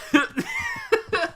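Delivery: high burst of laughter; real helpless giggle; giggling fit. Real, not acted. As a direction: break off and crack up. Breaks off question to laugh.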